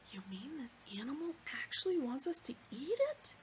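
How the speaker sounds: shocked breathless whisper. Acted. Trillian aside to Ford, whispering in disbelief. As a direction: lean in and whisper it. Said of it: "whispered Trillian to Ford".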